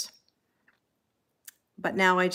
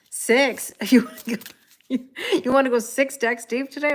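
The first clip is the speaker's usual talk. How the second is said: higher, louder, amused astonishment; laughing disbelief; 6 semitones higher, 5 dB louder than usual. Real, not acted. Reader to viewers asking for more decks, amused disbelief. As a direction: react in amused shock to a big ask. Taken aback by viewers' request.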